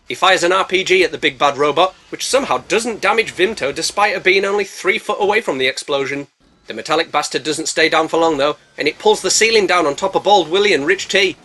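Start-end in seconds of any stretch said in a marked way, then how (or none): none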